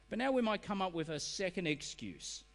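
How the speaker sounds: ordinary talk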